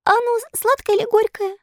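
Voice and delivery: high-pitched plaintive little puppet voice. A narrator's reading. Sick puppet asking fairy about the medicine, plaintive. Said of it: "спросил жалобным голосом".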